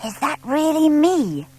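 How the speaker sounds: high, slow, wondering little owl; amazed owlet. A narrator's reading. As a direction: marvel at the picture. Plop sees finished drawing.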